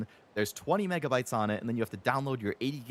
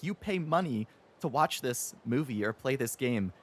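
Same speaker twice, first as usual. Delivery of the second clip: ordinary talk, nothing marked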